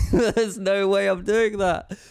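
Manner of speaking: high, slow, laughing disbelief; incredulous, drawn-out retold refusal. Laughing refusal of absurd request.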